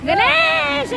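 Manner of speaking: calling Ganesh, high and loud; imitating a shout. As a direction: yell the name like big sister in the morning. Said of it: "పొట్టి అక్క ఎట్లా అరుస్తది". (అక్క అరిచినట్టు గట్టిగా పిలుపు)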